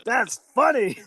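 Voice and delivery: high-pitched boss, amused by his own joke; Vince McMahon. Retold or imitated. Imitating the boss amused by himself.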